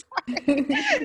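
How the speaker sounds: high, giggly apology; laughing sorry. Retold or imitated. Imitating the mom apologizing to the nanny, laughing. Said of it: "She turns and looks at me and says".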